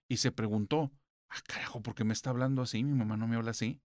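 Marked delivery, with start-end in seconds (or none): 1.3-3.8 hushed, scared whisper under the breath; frightened whisper. Retold or imitated. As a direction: whisper it as if afraid to be heard. Frightened daughter hears strange voice.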